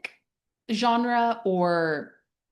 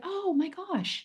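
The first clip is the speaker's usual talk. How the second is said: put-on much higher and softer, soothing a scared child; tender sympathy; 12 semitones higher, 5 dB quieter, slower than usual. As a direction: coo at a frightened little kid. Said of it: "We'd be like".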